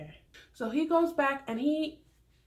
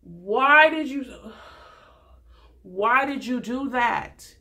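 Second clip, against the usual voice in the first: louder, slow dismay; exasperated disbelief; 7 dB louder, much slower than usual. Retold or imitated. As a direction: plead with him, appalled. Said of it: "And I was just like".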